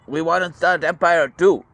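eager Japan. Retold or imitated. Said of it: "was like".

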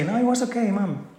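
put-on high, childish fib; kid fibbing to mom. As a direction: play the kid brushing it off to mom.

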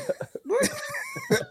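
a sudden squeaky burst of laughter; high laugh. Real, not acted. Host cracking up at the guest's claim, high squeaky laugh. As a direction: cracks up in disbelief.

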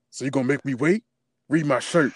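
cocky taunting impression, lightly voiced; mock Roman trash talk. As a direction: taunt like a smug champ. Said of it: "Roman was just talking mad spicy".

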